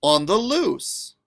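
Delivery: lively, sing-song picture-book reading; reading to children. A narrator's reading. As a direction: read it aloud to a class, playful and bouncy.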